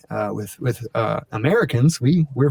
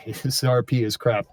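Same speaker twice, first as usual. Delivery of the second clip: mock British accent, dismissive; imitating unimpressed British friends; British friends' accent; 3 dB quieter than usual. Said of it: "my British friends are going".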